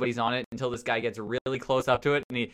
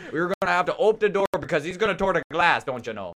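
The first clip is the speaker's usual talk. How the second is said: put-on Newfoundland accent; 5 semitones higher, 4 dB louder than usual. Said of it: "in my Newfoundland accent".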